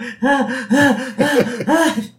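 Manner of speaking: loud, high mock panting; panicked panting. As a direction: pant hard, imitating frantic panic. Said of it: "So Marge, still panting".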